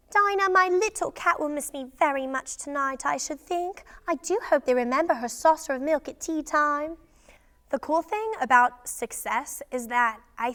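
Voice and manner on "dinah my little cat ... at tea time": shy but curious storybook character voice; higher-pitched, shy and wondering; Alice. Acted. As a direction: play a shy, curious heroine thinking aloud. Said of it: "Alice's voice was very, um, shy, but she was very curious".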